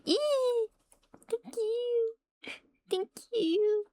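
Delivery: excited squeal. Real, not acted. Squealing at a surprise gift.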